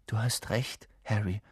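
soft, subdued agreement; hushed concession; quietly. A narrator's reading. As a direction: give in gently to your friend. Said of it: "sagte Hermine leise".

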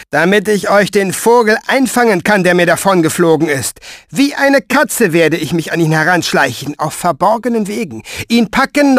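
a cruel soldier gloating over his prey; Pfeifer's eager boast. Acted. Pfeifer speaks, narrator voicing him. (höhere, lautere, gierige Soldatenstimme)

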